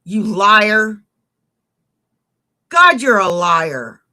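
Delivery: loud, teasing accusation; loud mock outrage. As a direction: call him a liar, loudly. Mock outrage at friend's claim.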